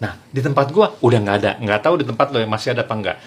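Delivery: normal talking voice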